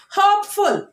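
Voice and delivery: "Hopeful" high and loud, weight on HOPE; first-syllable stress. Shown as a demonstration. Teacher models "Hopeful" for children, stressed on first syllable. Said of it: "we are stressing the first syllable".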